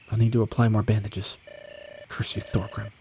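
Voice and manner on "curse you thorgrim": bitter muttered curse. Acted. Wounded knight alone.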